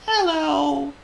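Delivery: gloomy, very high tiny mouse; Squeak. A narrator's reading. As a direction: squeak a glum little greeting. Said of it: "said Squeak gloomily".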